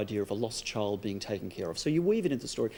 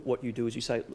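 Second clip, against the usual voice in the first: usual speaking voice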